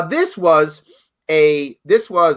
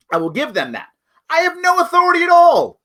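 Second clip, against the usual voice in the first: usual speaking voice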